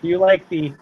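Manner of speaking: ordinary talk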